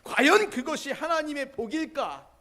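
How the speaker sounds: loud, high, ringing sermon voice; full-force preaching. Real, not acted. Pastor challenges the congregation about answered prayer, loud preaching. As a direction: preach it out to the whole hall.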